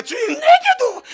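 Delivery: very high, slow, loud cry; shouted plea. Retold or imitated. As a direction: cry out to heaven, stretched long. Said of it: "누가 예배로 주를 만나".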